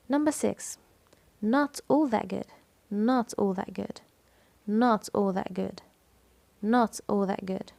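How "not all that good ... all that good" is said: slow phrase leaning on "Not"; "Not" stretched out, then "all that good" trailing; stress on NOT. Shown as a demonstration. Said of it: "Not is the stressed syllable". (التشديد على "Not")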